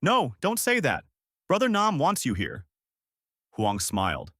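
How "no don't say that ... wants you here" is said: high, protesting shout. A narrator's reading. Young teacher refusing the farewell, high shout. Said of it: "Lan shouted".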